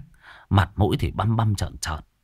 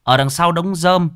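ordinary delivery

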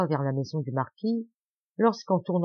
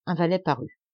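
plain reading voice

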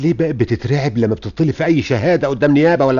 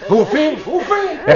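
higher, louder furious question; furious demand; 5 dB louder, slower than usual. Acted. Father demands where the attacker is, furious. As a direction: demand it, building.

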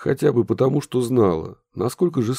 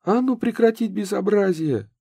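shouting; 12 semitones higher than usual. Acted. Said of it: "раздался крик".

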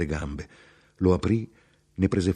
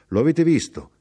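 raised, louder, animated voice; high, insistent chatter; talkative traveller, pressing his point; 10 semitones higher, 7 dB louder than usual. Acted. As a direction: press the point eagerly on a stranger.

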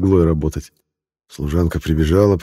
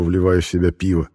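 plain reading voice

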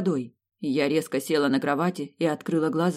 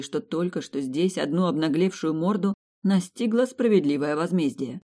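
usual speaking voice

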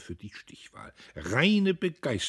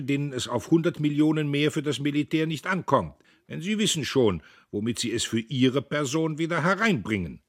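heated claim; 7 semitones higher, 5 dB louder than usual. A narrator's reading. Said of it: "behauptete Heuteufel".

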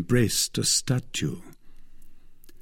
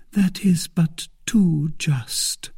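Milady seducing her defender, tender whisper; tenderly; 7 semitones higher, slower than usual. A narrator's reading. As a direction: breathe it tenderly. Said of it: "said she tenderly".

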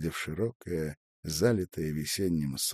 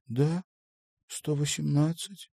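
higher voice at ordinary volume, unexcited; modest confirmation; 8 semitones higher than usual. A narrator's reading. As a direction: confirm the number without fuss. She confirms his amazed question. (скромно подтвердить, без нажима)

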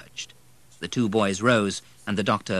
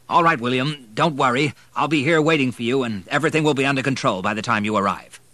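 louder, higher, firm reassurance; changed, brisk tone; 5 semitones higher, 6 dB louder, faster than usual. A narrator's reading. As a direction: take charge and reassure. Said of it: "Doctor Mackenzie's tone changed".

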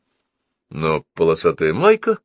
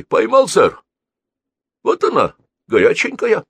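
high, louder exclamations; triumphant boy; 13 semitones higher, 6 dB louder than usual. A narrator's reading. Boy showing the caught bullet, triumphant. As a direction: crow over the catch.

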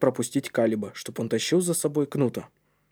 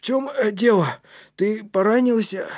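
put-on high, halting boy's voice; worried; 9 semitones higher than usual. A narrator's reading. Кнут asks if his friend is hurt, worried. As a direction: ask with alarm after a groan.

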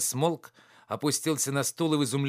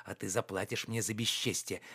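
lower, softer warning; low threat; 4 semitones lower, 8 dB quieter than usual. A narrator's reading. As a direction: drop the voice to threaten quietly. Sullen threat while preparing to leave. (тихая угроза)

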